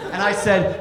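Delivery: ordinary delivery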